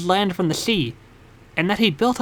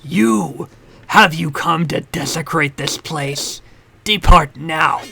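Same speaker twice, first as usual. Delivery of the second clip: low menacing guardian voice; 4 semitones lower, slower than usual. Acted.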